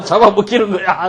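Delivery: high, hammed-up cry of alarm; mock panic. Child warning friends they will be eaten, mock panic. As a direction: warn them in exaggerated panic.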